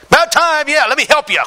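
high, loud mock mother, sarcastic; mother's sarcastic voice. Mother mocking her son's cleaning, sarcastic high voice. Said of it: "she's like".

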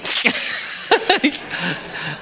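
a high, real burst of laughter; laughing at his own story. Real, not acted. Preacher laughing at his near-drowning tale, high laugh. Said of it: "I'm laughing now".

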